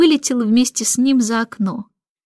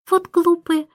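put-on higher-pitched baby thinking to herself; baby's scornful thought; 7 semitones higher than usual. A narrator's reading. Baby silently judging the admiring grown-ups, higher and dismissive. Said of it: "думала она".

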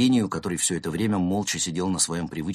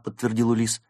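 ordinary delivery

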